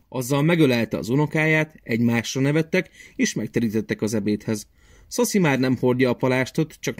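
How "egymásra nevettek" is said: plain reading voice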